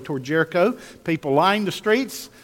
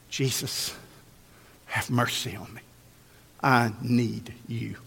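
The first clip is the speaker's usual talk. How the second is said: low, soft, slow pleading; hushed plea; 6 semitones lower, 7 dB quieter than usual. Acted. Blind man humbly asking Jesus for mercy, hushed plea. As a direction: plead quietly, with long pauses.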